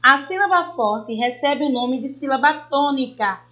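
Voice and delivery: ordinary talk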